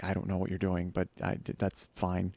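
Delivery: low, soft and unimpressed; subdued reply. Retold or imitated. Busy partner answering pestering photos, subdued.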